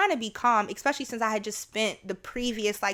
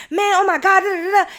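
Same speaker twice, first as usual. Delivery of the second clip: higher, louder rush of complaint; mock venting; 10 semitones higher, 9 dB louder, faster than usual. Imitating herself unloading on her partner each call. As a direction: spill out the day in a burst.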